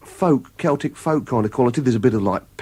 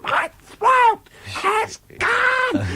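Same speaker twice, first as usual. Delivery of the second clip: much higher, slow, strained shout; mock anguished shout; much slower than usual. Singer shouting to the guitarist onstage, a mock strained cry. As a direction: cry it out as if the voice has gone. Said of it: "and she's shouting".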